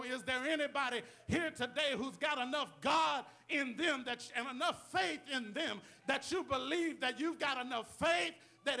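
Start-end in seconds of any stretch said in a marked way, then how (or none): none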